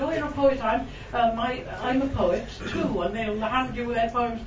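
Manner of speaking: ordinary talk, nothing marked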